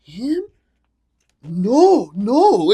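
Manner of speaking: mock-panicked disbelief; imitated freaking out. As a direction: act floored, then protest. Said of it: "starts freaking out".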